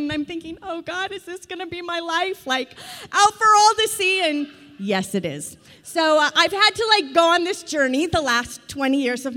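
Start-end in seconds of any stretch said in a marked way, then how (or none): 0.6-4.2 rising, half-comic despair, loud at the end; mock dismay. Wife asks God if this is her life, in comic dismay. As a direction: throw up your hands at God. Mom's dismayed inner cry.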